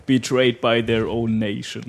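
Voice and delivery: epic voice-over, drawn out slowly; trailer announcer voice. Retold or imitated. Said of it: "diese Stimme von diesem Trailersprecher".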